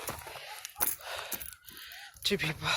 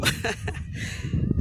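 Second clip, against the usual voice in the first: breathy high laugh; 4 dB quieter than usual. Real, not acted. Laughing while winded.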